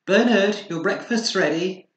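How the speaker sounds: high, loud call up the stairs; calling from downstairs. Acted. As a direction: mum calls up to her son. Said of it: "Mummy was shouting from downstairs".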